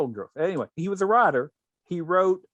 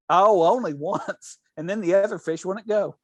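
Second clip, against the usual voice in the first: laughing through it; 4 semitones higher, 4 dB louder than usual. Retold or imitated. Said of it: "And go".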